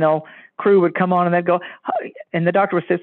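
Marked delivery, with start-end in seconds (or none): 1.8-2.1 squeaky hi. Retold or imitated. Guest character greets doctor in skit.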